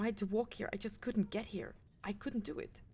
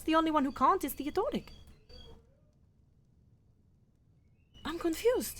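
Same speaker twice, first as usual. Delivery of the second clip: high, loud, disbelieving; shocked protest; 7 semitones higher, 8 dB louder than usual. Acted. Leader defending her reports to the mage, shocked and loud. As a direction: shout it out, stunned and hurt.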